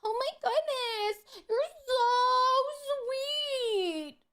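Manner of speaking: hammed-up sugary gratitude; mock gushing. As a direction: fake overwhelmed sweetness. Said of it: "Be like".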